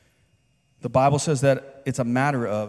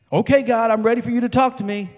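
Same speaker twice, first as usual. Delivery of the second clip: higher, louder, fast, over-eager churchgoer; mock eager prayer; 10 semitones higher, 9 dB louder than usual. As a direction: rattle it off like a keen worshipper demanding an answer. Mock eager churchgoer addressing God.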